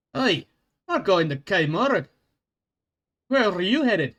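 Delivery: high, loud Scottish dwarf voice; a cheery Scottish dwarf greeting a stranger; loud Scottish brogue; Scottish accent. Acted. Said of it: "I'm trying to keep a consistent accent".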